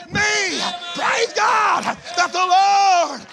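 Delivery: loud, high, sustained preacher's shout; preaching at full force. Real, not acted. Preacher shouting praise to his congregation, full force. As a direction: whoop it up to the congregation.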